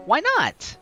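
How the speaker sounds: ordinary delivery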